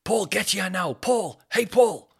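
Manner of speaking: fuming shopkeeper yelling. Retold or imitated. Shop owner yelling at Paul across the green, mock furious. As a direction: bellow the name across the green again and again. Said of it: "absolutely fuming at Paul".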